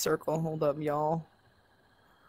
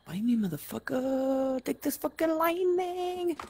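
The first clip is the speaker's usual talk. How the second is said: play-attack yell, pitched up and stretched out; mock battle cry; 8 semitones higher than usual. Player taunting a foe while throwing lightning, mock battle cry.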